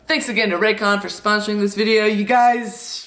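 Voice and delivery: ordinary delivery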